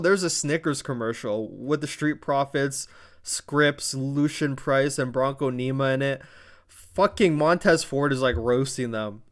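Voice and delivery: normal talking voice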